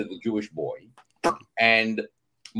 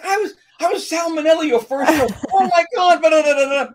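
loud, high, excited outburst; thrilled recognition; 8 dB louder, faster than usual. Retold or imitated. Actor reminding Jolie of her first show, thrilled recognition. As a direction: gush at top pitch, tumbling into babble. Acts out thrilled reunion at audition.

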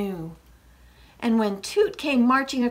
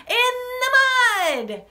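loud, slow, very high chant; gleeful squeal; 5 dB louder than usual. Shown as a demonstration. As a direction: squeal it long and high for children. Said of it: "So we're gonna say".

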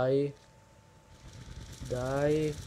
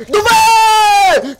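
much higher, louder yell; frustrated shout; 10 semitones higher, 7 dB louder than usual. Real, not acted. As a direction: bellow it searching the enemy. (grido esasperato)

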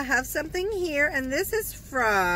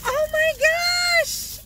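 much higher, slow, drawn-out delight; thrilled squeal; 4 dB louder, slower than usual. Real, not acted. Opening a gift from a viewer, a delighted squeal. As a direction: squeal it like a real surprise gift.

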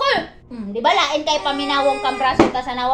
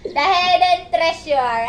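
slow tease; much slower than usual. Acted. Teasing slow drawl.

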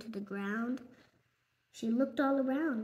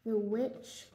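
plain reading voice

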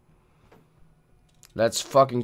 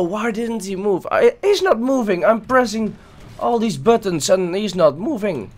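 frantic complaint; 7 semitones higher than usual. Real, not acted. Frantic at frozen character.

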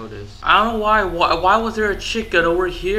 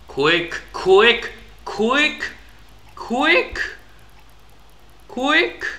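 each quick pitched higher, building panic; rising urgency; 8 semitones higher, much slower than usual. Real, not acted. Player urges the game on, pitch climbing. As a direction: hurry it up more and more.

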